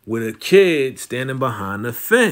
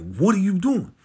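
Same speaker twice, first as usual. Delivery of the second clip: mock outraged challenge, pitched up; Vic going off; 7 semitones higher than usual. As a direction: imitate someone calling out a rival. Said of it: "Vic is going off on Walter".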